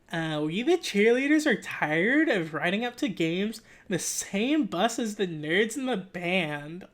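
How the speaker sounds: drawn-out, complaining cheerleader. Acted. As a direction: put on a whiny cheerleader character. Voices cheerleaders in the story.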